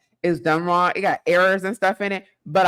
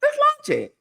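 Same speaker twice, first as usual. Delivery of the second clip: playful squeal. Acted. Urging friend to pick launch date.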